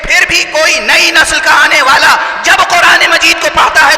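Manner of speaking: high, chanting list that swells to loud; building oratorical preaching. Real, not acted. Preacher listing scholar after scholar to the congregation, building oratorical preaching. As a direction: hammer each repeated phrase, building to full force.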